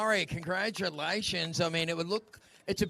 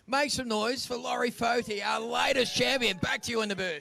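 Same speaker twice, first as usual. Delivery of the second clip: breathless sideline reporter; 6 semitones higher, 7 dB louder than usual. Real, not acted. Hyping crowd after champion interview.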